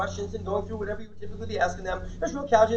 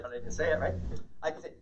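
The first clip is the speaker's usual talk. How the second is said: ordinary delivery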